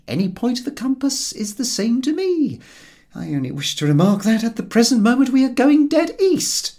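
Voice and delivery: a lightly voiced sardonic companion; George's dry voice. A narrator's reading. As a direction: lay the correction on dryly.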